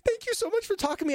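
high, rushed burst of thanks; mock gushing gratitude. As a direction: gush like an overeager mom. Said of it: "every part of my body wanted to say".

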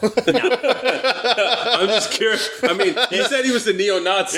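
high-pitched, laughing self-defence. Real, not acted. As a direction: say it through laughter, justifying the joke. Joking defence amid group laughter.

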